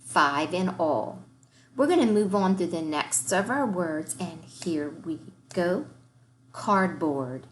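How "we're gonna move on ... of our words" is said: ordinary delivery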